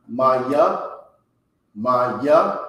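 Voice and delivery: Maya said as MAH-yah, two clear syllables; carefully pronounced Hebrew Maya. Shown as a demonstration. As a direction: sound out Maya slowly, ma then ya. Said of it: "the proper pronunciation for who is".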